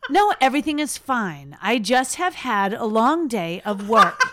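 slow, deliberate grown-up speech, laughter creeping in; over-measured adult calm, amused underneath; talking like this. Pretend. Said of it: "talking to everybody like this".

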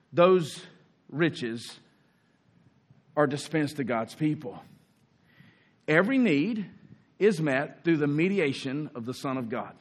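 ordinary talk, nothing marked

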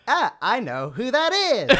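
high, drawn-out singer impression. Guest proving they know the singer, campy impression. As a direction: answer high and stretched, in mimicry. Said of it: "how to do an impression".

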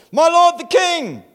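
loud high call across the hills. Acted. David calls out to the king on a far hill, loud and high. David calling to distant Saul.

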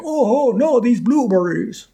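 high mock German accent. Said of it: "He's like".

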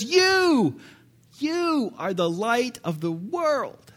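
Jesus naming his followers, slow and pointed; stress on you. Acted. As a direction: point straight at them with you. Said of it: "he then later says".

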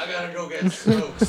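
a hint of a gruff dad; lightly voiced dad leaving; dad impression. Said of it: "here's an impression of my dad".

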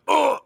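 high-pitched sudden "Oh!"; startled yelp. Retold or imitated. Dad retelling son's splash on his back, startled yelp. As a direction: yelp as if landed on mid-call. Retells being jumped on by child.

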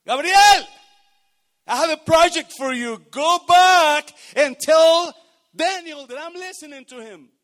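high, loud, booming divine command; voice of God. Retold or imitated. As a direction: proclaim it as God sending Gabriel. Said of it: "Y por eso Dios dice".

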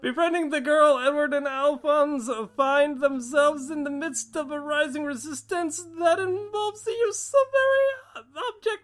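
high, climbing trailer voice; dramatic rising voice. Acted. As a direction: build up like a movie trailer. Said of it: "Go higher".

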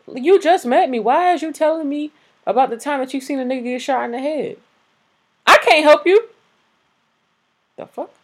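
high, loud indignant protest; worked-up exasperation. Real, not acted. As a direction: push the voice way up in exasperated disbelief. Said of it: "that be getting me tight".

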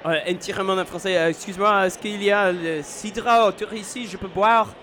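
same request, now all in French; speaking French. Shown as a demonstration. Guest asked to redo it in French, speaking French. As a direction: switch fully into French. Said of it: "now do it in French".

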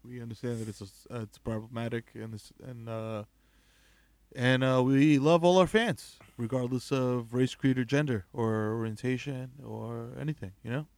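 soft, hushed apology voice; hushed and grave, like a press statement; mock solemn apology. Hosts fake-apologizing to fans, hushed and grave.

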